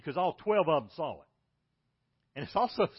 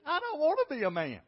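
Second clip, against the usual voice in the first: put-on whiny child; 11 semitones higher, 4 dB louder than usual. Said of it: "He said".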